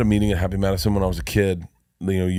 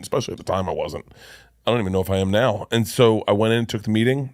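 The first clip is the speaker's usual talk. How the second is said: normal talking voice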